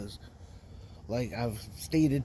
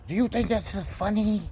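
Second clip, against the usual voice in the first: mock angry yell; 8 semitones higher than usual. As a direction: repeat her accusation as a hammed-up yell. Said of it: "this is her opening statement to me".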